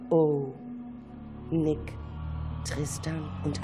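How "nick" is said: usual speaking voice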